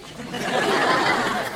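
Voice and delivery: a breathy, airy chuckle; laugh. Real, not acted. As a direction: chuckle at the cheeky suggestion. Auntie amused by the ironing.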